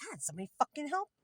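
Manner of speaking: retold exasperated outburst, pitched high; pissed off. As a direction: act out fed-up frustration. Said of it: "starting to get pissed".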